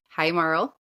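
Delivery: ordinary talk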